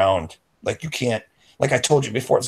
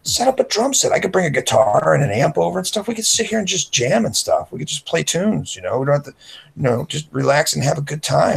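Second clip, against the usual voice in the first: higher, eager selling voice; higher, upbeat persuading; coaxing pitch; 8 semitones higher than usual. Retold or imitated. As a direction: sell him on the jam session. Coaxing friend with jam sessions.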